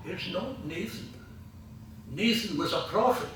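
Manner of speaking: high-pitched mock reproof; old actor's correction. Old actor correcting a novice's diction, high-pitched reproof. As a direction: imitate an old Scottish actor putting someone right. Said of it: "And Jimmy says".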